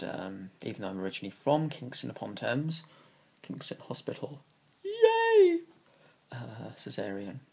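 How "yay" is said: mock cheer. Cheering about own birthplace.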